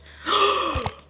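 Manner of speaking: loud shocked gasp; sudden high gasp; sharp gasp. Real, not acted. Shocked reaction to spoiler.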